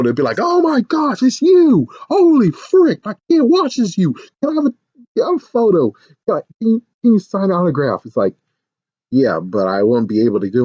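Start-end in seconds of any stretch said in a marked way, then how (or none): 0.4-8.0 high, breathless-with-excitement mock fan; gushing fan. Fan at the door begging for an autograph, gushing. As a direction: babble excitedly in a high pitch. Customers recognizing a celebrity worker.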